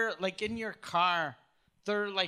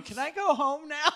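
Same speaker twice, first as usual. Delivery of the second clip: higher-pitched mock plea of a wife; pleading wife; 8 semitones higher, 4 dB louder than usual. Woman to her husband at the club, mock pleading. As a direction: plead in a higher, louder put-on voice. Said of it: "looking at her husband going".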